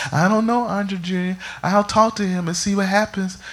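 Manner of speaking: high, worried mother's voice; imitating mom. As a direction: sigh it out like a helpless mom. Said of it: "That's exactly how my mom sounds".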